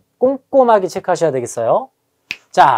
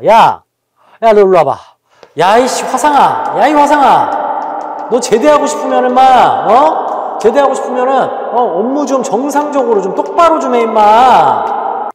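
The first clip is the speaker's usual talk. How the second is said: loud, high, drawn-out scolding; angry senior soldier; 5 semitones higher, 4 dB louder than usual. Acted. Army senior scolds a useless new recruit, angry shout. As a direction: chew out the clumsy new recruit, stretching the endings. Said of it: "막 이제 화를 냅니다".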